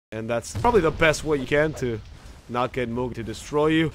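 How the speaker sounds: ordinary delivery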